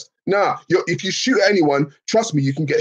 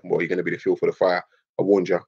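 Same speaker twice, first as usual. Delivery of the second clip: lower, softer and fast smug warning; ominous preacher; 8 semitones lower, 4 dB quieter, faster than usual. Retold or imitated. Believer warning him he'll fuel the fire, low menace. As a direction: deliver it like a cold doom prophecy.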